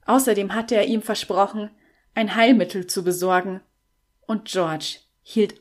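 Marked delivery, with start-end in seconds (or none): none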